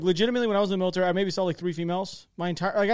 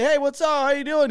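higher-pitched, breezy mock hello; casual greeting; 7 semitones higher, 4 dB louder than usual. Imagined LT greeting his sister as she runs by, casual greeting. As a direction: toss out an imagined friendly hello.

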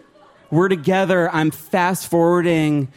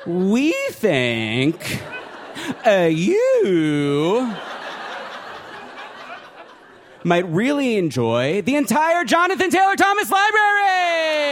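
mock cheery website voice; 7 semitones higher, slower than usual. Website pitching him shows.

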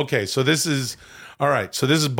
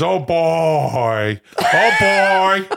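drawn-out hammy exclamation, swelling louder; Robert De Niro doing Al Pacino; 4 dB louder, much slower than usual. Acted. De Niro imitating his friend Al, hammy and swelling. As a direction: build a mock actor holler. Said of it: "this is now De Niro doing".

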